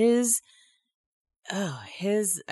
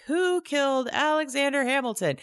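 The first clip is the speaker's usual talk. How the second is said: radio quiz voice; 9 semitones higher than usual. Retold or imitated. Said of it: "the thing is like".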